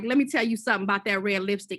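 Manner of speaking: normal talking voice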